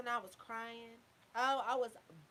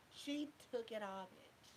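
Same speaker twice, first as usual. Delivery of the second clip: ordinary talk, nothing marked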